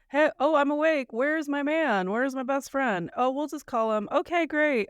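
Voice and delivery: high-pitched, chirpy play-acting; breezy pretend waking. Patient waking alone in hospital, breezy mock cheerfulness.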